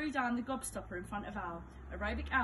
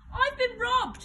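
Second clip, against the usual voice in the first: very high, loud outraged scream; 11 dB louder, slower than usual. A narrator's reading. Al protesting his loss, loud high scream. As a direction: howl at the injustice. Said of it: "screamed Aerobic Al".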